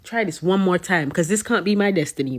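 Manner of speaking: loud, insistent protest; loud, fed-up disbelief; exasperated retry. Acted. Desperate retry after bad result.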